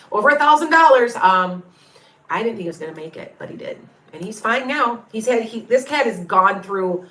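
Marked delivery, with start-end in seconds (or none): 0.1-1.1 higher, louder and fast, laughing; laughing disbelief. Real, not acted. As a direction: laugh at what the bill cost. Laughing at the vet bill.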